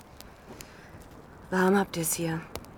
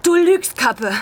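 loud, high accusation; disbelieving cry; 6 semitones higher, 6 dB louder, slower than usual. Acted. Girl shouts that he is lying about Anna's death. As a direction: cry it out in denial. Girl refuses to believe Anna dead.

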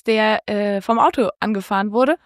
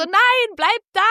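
urgent retold shout; 10 semitones higher, slower than usual. She calls out to stop him.

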